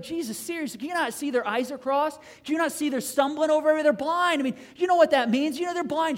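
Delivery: loud, high-pitched acted disbelief; hammed-up, exasperated questioning; incredulous protest. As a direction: object like an exasperated bystander. Bystanders protest to Jesus in disbelief.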